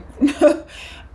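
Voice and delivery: quick breathy laugh; a laugh at herself; short laugh. Real, not acted. Laughing as she tells of going back to God.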